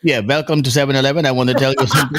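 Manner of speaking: put-on Indian accent; comic impression with an Indian accent. Joking as the Indian dude from the FBI, Indian accent. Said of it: "When I do my Indian accent".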